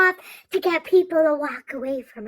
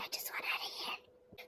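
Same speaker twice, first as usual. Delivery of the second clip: fed-up whisper under breath; 21 dB quieter than usual. Retold or imitated. Granddaughter whispering fed up in the store, voiceless whisper. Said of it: "And I'm like".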